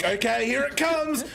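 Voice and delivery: high, slow, drawn-out yell before impact; bracing shout. Retold or imitated. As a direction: brace for the hit and shout. Bracing for the crash.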